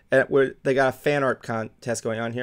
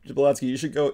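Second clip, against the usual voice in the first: usual speaking voice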